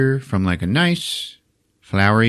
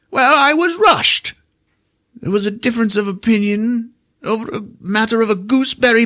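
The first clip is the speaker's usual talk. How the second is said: pitched-up haughty excuse; fussy wizard voice; 12 semitones higher than usual. Retold or imitated. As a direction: huff it like an offended little wizard. Comic wizard voice in retelling.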